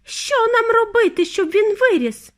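high, loud lament; distraught high cry; wailing mother. A narrator's reading. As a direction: wail desperately for help. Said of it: "голосила пані Тіві".

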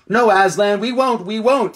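high, earnest chorus of promises; eager protest. Acted. Creatures promising the lion they will obey, eager protest. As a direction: pipe up eagerly and insistently.

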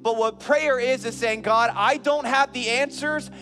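loud, high preaching build; full-force preaching. Acted. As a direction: preach it out to the congregation, rising.

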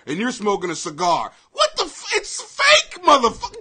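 usual speaking voice

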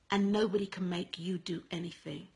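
sudden unvoiced whisper for emphasis. Real, not acted. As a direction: drop to a whisper to drive the point home. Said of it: "sudden whisper for emphasis".

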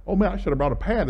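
high, hurried imitation of frantic thoughts; racing mind. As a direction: blurt it like thoughts running wild. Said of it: "my mind went nuts".

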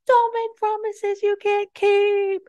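high, slow, drawn-out singsong warning; mock scolding. Teller heckling the operator's promise, mock scolding. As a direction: chide in a high, stretched-out singsong.

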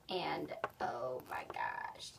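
soft, breathless whisper; barely voiced amazed whisper; awed whisper. Real, not acted. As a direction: whisper in awe. Awed murmur.

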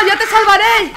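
loud, high-pitched hammed-up rescue cry; heroic shout. Real, not acted. Player saving a teammate, playfully heroic.

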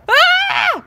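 high, loud shriek; terrified yelp; shrill panicked cry; scream. Acted. Pencil screams at Sharpener.

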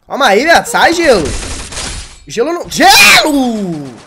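high, loud panicked yelling; frantic shouting mid-fight; panicking gamer. Real, not acted.

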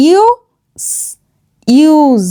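ইউ drawn out as a long 'yoo'; long U sound. Shown as a demonstration. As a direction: sound out ইউ, then ইউজ with a long vowel. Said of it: "ইউ এর long sound হয়ে গেল ইউ". (লম্বা 'ইউ' ধ্বনি)

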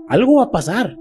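usual speaking voice

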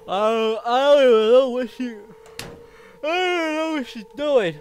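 loud panicked rising rant; scared horror game streamer. Real, not acted. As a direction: freak out in fear.

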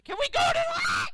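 very high, loud screamed plea; quick, desperate fan scream; frantic shout. Real, not acted. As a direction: shriek it fast at the driver. Yelling at race for lead.

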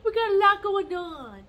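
excited, high and loud; excited high repeat. Real, not acted. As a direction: throw the line up high and loud.

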